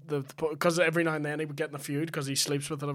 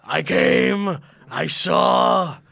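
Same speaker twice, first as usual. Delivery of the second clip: loud mock victory declaration; mock proclamation; 2 semitones lower, 9 dB louder, much slower than usual. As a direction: proclaim it like a grand speech. Quoting a punchline for laughs.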